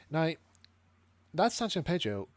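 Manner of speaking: normal talking voice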